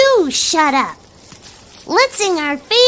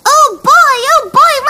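excited; 4 semitones higher, 6 dB louder than usual. Acted. Excited kid cheering.

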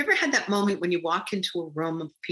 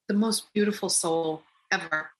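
ordinary talk

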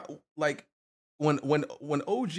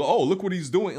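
mock tattling; 8 semitones higher, 6 dB louder than usual. Mocking critics labeling him.

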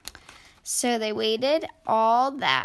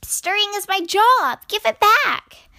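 high, loud squeaky squirrel voice; 9 semitones higher, 8 dB louder than usual. A narrator's reading. Squirrel demanding the spoon back from duck, high squeak. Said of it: "squeaked the squirrel".

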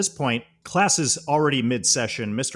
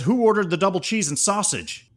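higher, lively delivery call to the room; delivery guy call; 8 semitones higher, 4 dB louder than usual. Retold or imitated. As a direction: call out the order to the whole class. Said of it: "and says".

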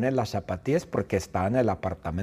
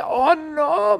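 high loud panicked oh no; alarmed cry; 4 dB louder than usual. Acted. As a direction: squeal in sudden alarm. Mr Bean remembers dentist. (grito agudo de alarma)

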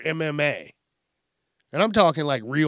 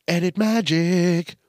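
slow, loud, higher sing-out; showy announcement; 4 semitones higher, 5 dB louder, slower than usual. Real, not acted. Host returning after a sneeze, showy announcement. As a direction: stretch it out like a magic reveal.

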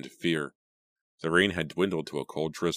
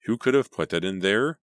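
higher and louder, pressing for an answer; sharp, raised demand; 5 semitones higher, 6 dB louder than usual. A narrator's reading. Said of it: "Randy demanded".

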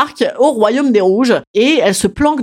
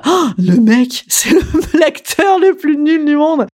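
laughing scorn; 6 semitones higher, slower than usual. Real, not acted. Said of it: "laughing scorn at the prince".

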